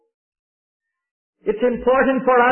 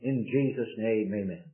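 a hurried prayer that fades to a mutter; tossed-off prayer trailing away; the preacher mocks a lazy prayer, fading out; mumbled quick prayer; 8 semitones lower, 6 dB quieter than usual. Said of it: "Want your preacher to not preach so long?".

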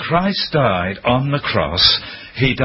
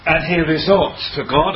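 ordinary talk, nothing marked

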